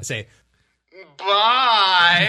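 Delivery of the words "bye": tearful goodbye. Acted. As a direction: voice breaking into a cry. Player says goodbye in character.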